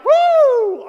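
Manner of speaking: loud, high whoop of joy; joyful whoop. Pretend. Believer bursting with excitement inside, loud joyful whoop. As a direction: let out a big cheer. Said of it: "And you're like".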